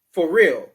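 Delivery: loud "Forreal" as one word. Shown as a demonstration. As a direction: squash "For real" into a single word. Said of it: "you say it like it's one word".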